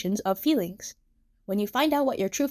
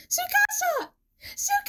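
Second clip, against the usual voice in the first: very high alarmed cry; Emu; slower than usual. A narrator's reading. Emu calls to fallen Tsukasa, alarmed high cry. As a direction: call his name in panic, cut off.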